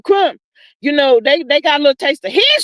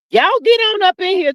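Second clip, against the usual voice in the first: usual speaking voice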